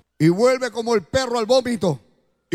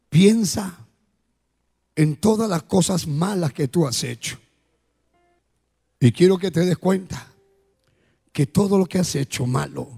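altar call; 5 semitones lower than usual. Acted. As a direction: invite them gently, eyes closed. Altar call over music.

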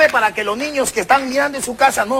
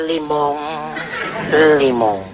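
mock aroused man, low; lower, put-on lovemaking voice; man's code-word moan; 8 semitones lower, slower than usual. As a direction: drop low for a hammed-up moan. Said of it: "cuando me excito voy a decir".

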